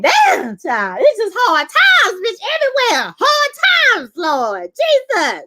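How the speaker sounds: high-pitched comic wailing exclamation; exaggerated dismay. Viewer reacting to a character's money troubles, exaggerated dismay. Recaps her exclamation at show's hardship.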